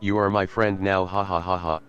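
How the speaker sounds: normal talking voice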